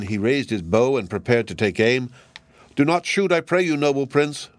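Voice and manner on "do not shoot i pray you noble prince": high, pleading bird cry; crying stork. Acted. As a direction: beg the prince to spare you, pitched up high. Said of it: "cried the stork".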